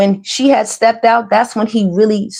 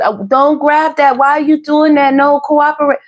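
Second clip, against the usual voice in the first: higher, mildly aggravated mom voice; imitating mom; 7 semitones higher than usual. Vlogger mimics the mom scolding her baby, mildly aggravated. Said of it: "And goes out".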